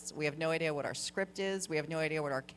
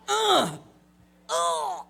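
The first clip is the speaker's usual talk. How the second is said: loud strained grunt; effort grunt; 13 semitones higher, 8 dB louder than usual. Shown as a demonstration. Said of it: "the perfect combination of poop and sex".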